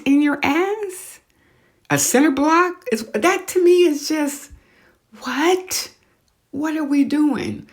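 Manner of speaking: high, disbelieving outcry; can't believe what people do; incredulous. Real, not acted. Podcast host mocking butt implants, incredulous and high.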